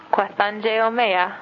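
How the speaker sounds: slow, careful Kwathange omeya; asking politely for water, slow and careful; polite request. Shown as a demonstration. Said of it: "that would be very polite".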